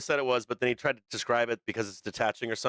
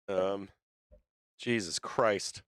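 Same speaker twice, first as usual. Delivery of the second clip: slow, tearful Jesus Christ; crying; 2 semitones lower, 3 dB quieter, much slower than usual. Real, not acted. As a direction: let it come out through real tears. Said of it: "Stop with me crying".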